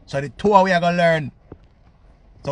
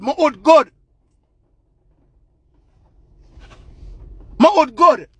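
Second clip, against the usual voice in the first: high, loud indignant outburst; indignant boast; 5 semitones higher, 5 dB louder than usual. Real, not acted. Indignant boast during rant.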